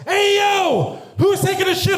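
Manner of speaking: high, loud shout into the room; loud call-out. Retold or imitated. Friend bursting into a bathroom, loud high call.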